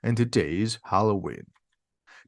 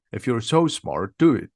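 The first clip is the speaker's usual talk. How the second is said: ordinary delivery, nothing marked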